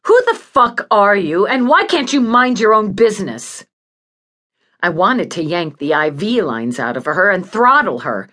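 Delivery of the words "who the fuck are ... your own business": loud, higher hostile demand; angry challenge. Acted. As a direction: round on a nosy stranger, voice raised. Patient turns on visitor.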